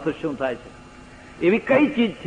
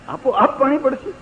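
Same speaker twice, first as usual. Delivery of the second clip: amazed echo; 8 semitones higher than usual. Retold or imitated. Amazed repetition.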